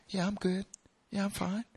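light, too-quick reassurance; breezy denial. Acted. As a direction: act like nothing is wrong. Acting someone hiding a tough time.

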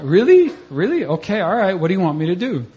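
can't believe what you're being asked; incredulous. Retold or imitated. Teller retelling his disbelief at God's instruction, high and incredulous. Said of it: "So I was like".

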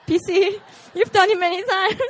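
loud, high teasing accusation; playful call-out. Real, not acted. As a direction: call someone out across the room, about to laugh. Playfully calling out a listener.

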